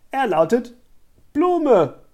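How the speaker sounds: high, loud announcement; mock proclamation. A narrator's reading. Scarlett makes fun of the flower girl, proclaiming loudly.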